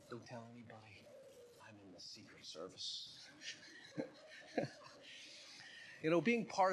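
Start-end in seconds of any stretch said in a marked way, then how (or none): 0.1-3.1 hushed, much softer and lower; whispered confession. Acted. Man whispering his excuse back to the pastor, hushed. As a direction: drop to a secretive murmur. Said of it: "mentions back and whispered".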